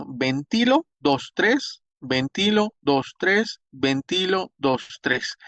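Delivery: "ventilo, dos, tres" in a steady, repeated beat, pitch lifted; rhythmic count aloud. Shown as a demonstration. Teacher showing staff how to mark the ventilation rhythm, "ventilo, dos, tres" counted rhythmically. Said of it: "la vamos a ir marcando con nuestra voz".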